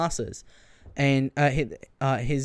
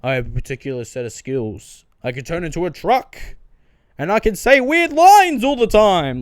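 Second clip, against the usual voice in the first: Liam Neeson impression; 2 semitones higher, 8 dB louder than usual. Joking about Liam Neeson's casting, parodying his famous threat line. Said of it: "I heard a joke about this where he was like".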